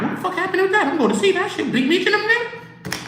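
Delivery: very high-pitched fan voice; excited onlooker. Retold or imitated. Fan reacting to Meech popping out, high excited voice. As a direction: squeeze it up high, mimicking a curious crowd.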